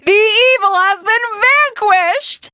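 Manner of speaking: slow, high, grand victory cry; triumphant declaration. Acted. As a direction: announce the win grandly. Triumph after the battle.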